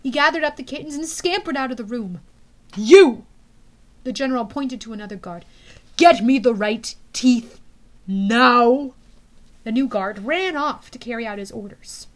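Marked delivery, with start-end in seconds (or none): 2.7-3.3 loud bark. Acted. General calls a guard. 6.0-8.9 angry order. Acted. General orders.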